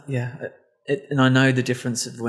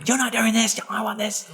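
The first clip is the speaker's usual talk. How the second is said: much higher mock-entitled demand; entitled Karen; 10 semitones higher than usual. Friend mocks a Karen blaming people, hammed-up demand. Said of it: "they would be all about the blame".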